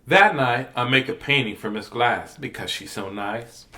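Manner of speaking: put-on gentle read-aloud to kids; picture book read to children; storybook reading.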